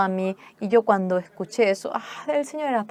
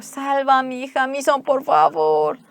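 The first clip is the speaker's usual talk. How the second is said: tearful plea; 6 semitones higher, 5 dB louder than usual. Retold or imitated. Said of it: "empezó a llorar".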